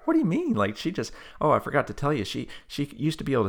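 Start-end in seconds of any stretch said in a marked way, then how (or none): 0.1-0.5 high, loud challenge; incredulous. Retold or imitated. Reacting to her instant refusal, loudly incredulous. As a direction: demand an explanation in disbelief, pitched way up.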